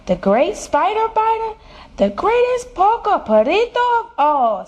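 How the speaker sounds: put-on high, grandiose Spanish-accented announcement; boastful Spanish-accented hero. A narrator's reading. Kitten in mask announcing his titles, boastful Spanish accent. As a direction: proclaim each title with a rising Spanish-accented flourish.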